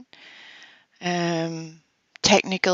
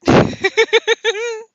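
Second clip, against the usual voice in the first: laughing squeal; 8 dB louder than usual. Real, not acted. Laughing outburst at gendering.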